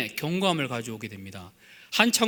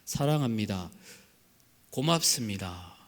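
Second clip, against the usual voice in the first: tender thanks; 8 semitones lower, 6 dB quieter than usual. Acted. Forgotten words said tenderly.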